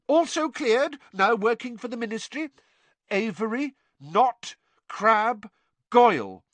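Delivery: put-on high, insistent boy voice; Harry naming Death Eaters, insistent; urgent Harry. A narrator's reading. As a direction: press the names on him. Harry listing names.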